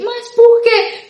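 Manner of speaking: high, slow puzzled question; drawn-out high bewilderment; baffled gringo. Retold or imitated. Gringo friend asks why she must leave, high and slow.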